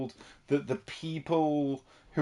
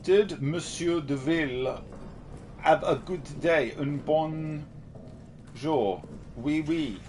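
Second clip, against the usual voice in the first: higher, slow mock French accent; 4 semitones higher, slower than usual. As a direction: read the question like a French waiter. Reading chat in French voice.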